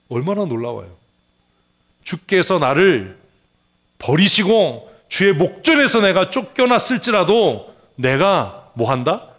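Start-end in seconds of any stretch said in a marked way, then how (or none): 2.0-9.3 loud, high, drawn-out proclamation; forceful preaching. Real, not acted. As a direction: proclaim it to the whole congregation. (크고 높게 늘여 외치는 설교)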